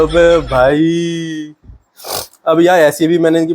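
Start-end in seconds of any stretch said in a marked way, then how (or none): 0.0-1.5 long, stretched-out reaction of disbelief; slow, incredulous groan of words; drawn-out disbelief. Real, not acted. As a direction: drag the words out, dumbfounded. Stunned reaction to the clip's pun.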